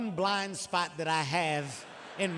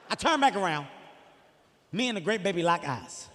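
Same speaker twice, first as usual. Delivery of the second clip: normal talking voice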